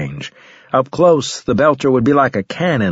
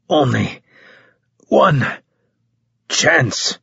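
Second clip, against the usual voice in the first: halting, word by word through pain; wounded soldier forcing words out; pained panting; 4 semitones higher, slower than usual. A narrator's reading. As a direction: gasp out each word separately, long pauses between. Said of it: "panting and in pain".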